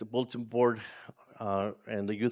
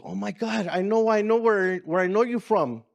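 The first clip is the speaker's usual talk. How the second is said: sudden high gasp of recognition, louder; surprised recognition; 8 semitones higher, 4 dB louder than usual. Retold or imitated. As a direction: it suddenly clicks for her. Mom jumps up recognizing him.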